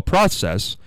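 process said "PRAH-sess"; common short-o pronunciation. Shown as a demonstration. Host giving an everyday example, process with "ah". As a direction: process with an ah vowel. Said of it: "might be how we say it".

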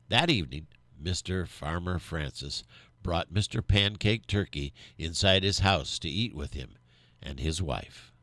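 usual speaking voice

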